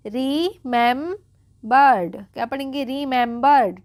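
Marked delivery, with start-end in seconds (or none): 0.1-2.2 drawn out syllable by syllable; slow syllable by syllable. Shown as a demonstration. Teacher sounding out a word for students, very slowly. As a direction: break the word into slow parts. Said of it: "देखिए आगे पढ़ेंगे".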